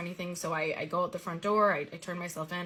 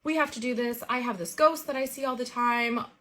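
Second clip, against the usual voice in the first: lightly imitated excited cousin, a bit higher and louder; eager, pitched-up imitation of a thrilled cousin; 5 semitones higher, 4 dB louder than usual. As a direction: play the cousin gushing with excitement. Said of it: "gets excited and is like".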